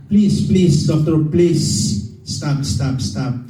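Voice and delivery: husband loudly begs the doctor to stop talking; pleading. Retold or imitated. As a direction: beg the doctor to stop, loud and urgent. Retelling begging doctor to stop.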